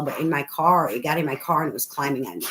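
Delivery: normal talking voice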